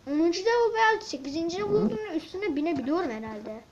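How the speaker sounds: usual speaking voice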